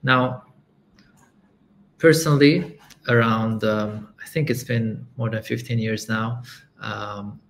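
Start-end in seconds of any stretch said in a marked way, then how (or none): none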